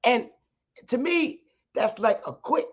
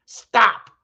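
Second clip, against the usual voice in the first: firm command; 2 semitones higher, 7 dB louder than usual. Real, not acted. Ordering intruders to leave her alone.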